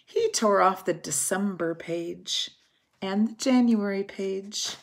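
plain reading voice

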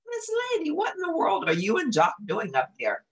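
put-on high, amazed old Southern gent; surprised Southern hound. Trusty finds Lady and Jock stacked up, surprised. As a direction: gasp it out like a startled old dog. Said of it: "even more surprised than Jock".

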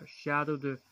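usual speaking voice

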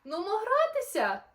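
high, loud cheerful shout; excited call. Acted. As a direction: call him in to play. Dingoes invite Kevin.

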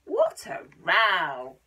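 slow, loud stretched exclamation; slow emphasis. A narrator's reading. Telling children about the animals' racket, slow loud. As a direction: draw out the row.

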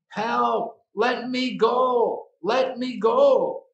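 high, loud, drawn-out pleas; shouting for help. Acted. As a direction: cry out to be released. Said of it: "he was shouting".

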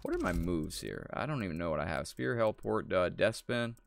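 ordinary delivery

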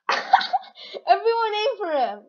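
high, amused, breaking out of a laugh; laugh, then get the words out slowly. Real, not acted. Player amused that everyone targeted one person, laughing.

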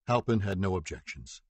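plain reading voice

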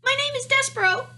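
very high, loud squeak; shouting little mouse. A narrator's reading. Mouse calls his name to the princess, shouting squeakily. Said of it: "shouted to the princess".

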